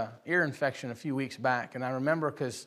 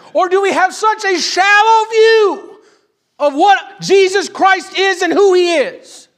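high, shouted sermon building in intensity; full-force preaching; 11 semitones higher, 11 dB louder than usual. Real, not acted. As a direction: thunder the challenge at the congregation, stretching key words.

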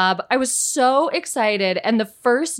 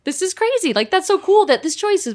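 higher-pitched, mildly giddy delight; thrilled satisfaction; 6 semitones higher than usual. Retold or imitated. Actress hearing her choice given to others, thrilled satisfaction. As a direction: replay being thrilled by a secret win. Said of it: "an amazing satisfaction of".

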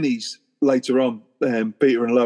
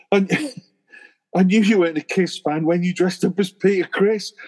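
Peter Griffin quote; 6 semitones higher than usual. Retold or imitated. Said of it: "Peter says to her".

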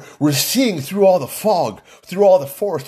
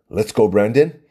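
ordinary talk, nothing marked

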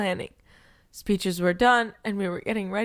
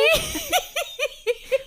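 high burst of laughter. Real, not acted. Embarrassed over pronunciation.

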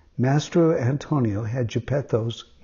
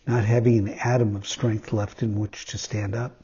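plain reading voice

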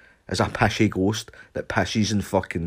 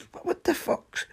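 incredulous outburst; 5 dB quieter than usual. Retold or imitated. Finding jeans soaking.

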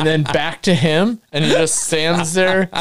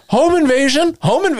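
much higher, breathless alarm to the cops; urgent report; 10 semitones higher than usual. Retold or imitated. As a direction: blurt it out in a panic. Rushing up to police.

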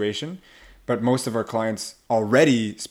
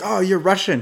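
high, louder exclamation of discovery; pitched-up, presumptuous "oh!"; mock realization; 9 semitones higher, 6 dB louder than usual. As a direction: imitate someone jumping to a conclusion. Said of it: "people are like".

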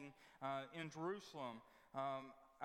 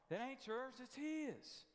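rising preaching; 6 semitones higher, slower than usual. Real, not acted. Preacher rebuking the church, raised and urgent. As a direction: pitch jumps up, hammering the point.

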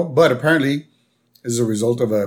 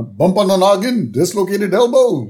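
high, singsong mock diagnosis; imitated cartoon doctor; 7 semitones higher than usual. Doctor listing the boy's injuries, high-pitched mock pronouncement. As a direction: list injuries in a raised put-on voice.

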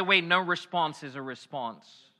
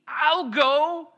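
high, loud eager volunteer; Isaiah answering God's call; eager shout; 12 semitones higher, 8 dB louder, much slower than usual. Acted. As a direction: call out ready to go. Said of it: "Isaiah's like".

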